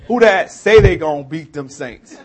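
New Orleans accent. Shown as a demonstration. Local showing outsiders what we say, in a loud New Orleans accent. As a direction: belt it out in New Orleans dialect. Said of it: "But what we say is".

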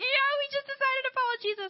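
high, excited gush; imitated giddy announcement. New believers telling the chapter, high and giddy.

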